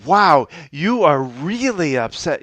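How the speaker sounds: high, loud, animated wow; loud energetic empathy; matching her energy. Shown as a demonstration. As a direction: meet their big energy with a bright, loud reply. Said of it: "if she was really coming out with a high energy".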